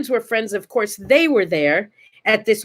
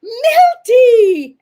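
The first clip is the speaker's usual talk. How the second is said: high, loud, drawn-out call; yelling a name; 13 semitones higher, 7 dB louder than usual. Retold or imitated. Young fan running to greet Milton Berle, yelling his name. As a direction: holler it across the airport. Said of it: "yelling your name".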